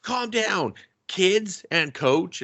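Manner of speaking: high put-on kid voice, stretched out; kid host hushing crowd. Kid host calming kids and coach, high drawn-out imitation. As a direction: imitate a self-important kid settling everyone.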